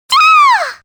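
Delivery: very high, loud shriek; startled shriek; high scream. Acted. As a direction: shriek in sudden fright. Character screams.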